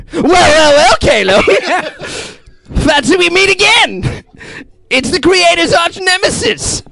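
very high, theatrical arch-nemesis voice; the Guardian. Acted. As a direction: greet an old foe in a hammy, high-pitched hero voice. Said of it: "the Guardian character".

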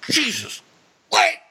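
high frantic stuttering yell; shouting Austin. Retold or imitated. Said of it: "his caps locking every other word".

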